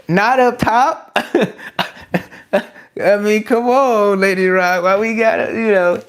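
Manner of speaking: a lightly put-on high teasing voice; mock scolding a chatter, half laughing; playful mock voice. Mock scolding Lady Rock, laughing.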